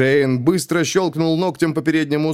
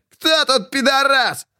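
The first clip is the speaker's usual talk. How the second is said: very high, slow roaring taunt; roared taunt; slower than usual. A narrator's reading. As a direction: roar the question in triumph. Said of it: "рычал".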